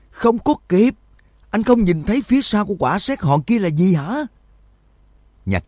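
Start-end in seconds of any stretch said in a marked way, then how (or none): 0.1-4.2 very high, strained cry; frantic warning. A narrator's reading. Shin stops his companion near the ball lightning, crying out frantically. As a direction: pitch it right up in alarm.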